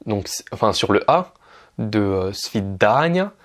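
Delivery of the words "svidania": svidania said very slowly, syllable by syllable. Shown as a demonstration. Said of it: "l'accentuation elle est sur le da".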